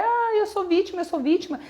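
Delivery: mock someone wallowing in being a victim; mock self-pity. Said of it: "vai ficar". (imitação debochada e chorosa de vítima)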